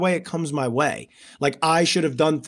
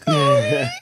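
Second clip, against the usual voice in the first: high squealing laugh; 4 dB louder than usual. Real, not acted. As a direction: burst of high-pitched laughter breaking into yeah. Laughing at emotional story.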